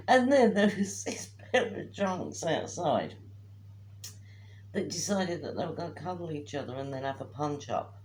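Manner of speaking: ordinary talk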